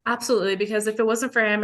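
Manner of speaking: ordinary talk, nothing marked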